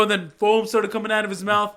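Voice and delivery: normal talking voice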